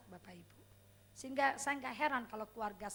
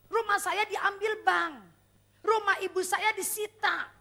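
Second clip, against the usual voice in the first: fervent testimony; 5 semitones higher, 10 dB louder than usual. Real, not acted. Preacher recounting his humiliation to the church, fervent and loud. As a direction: testify with full conviction.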